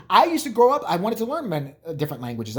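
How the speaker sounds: usual speaking voice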